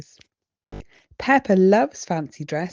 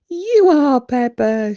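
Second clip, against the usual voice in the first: high, loud giggly answer; giggling as the magic mirror; 6 semitones higher, 9 dB louder, slower than usual. A narrator's reading. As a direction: giggle the words out. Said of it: "she giggles".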